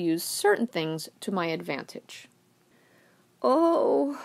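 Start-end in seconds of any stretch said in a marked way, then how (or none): 3.4-4.2 faked moan. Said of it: "I moaned and gasped for air".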